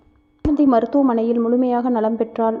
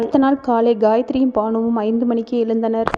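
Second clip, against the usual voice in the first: usual speaking voice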